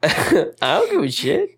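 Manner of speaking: laughing through the words; squeaky laugh on every word. Real, not acted. Laughing while talking.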